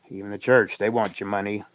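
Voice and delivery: normal talking voice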